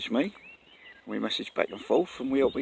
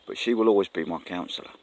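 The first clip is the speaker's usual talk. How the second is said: normal talking voice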